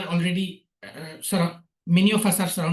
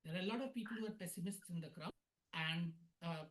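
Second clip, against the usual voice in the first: barely audible; 21 dB quieter than usual. Real, not acted. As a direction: voice very faint and distant. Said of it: "but your voice is very low".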